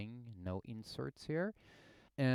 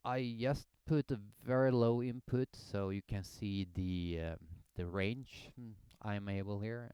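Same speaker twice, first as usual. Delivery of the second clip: ordinary delivery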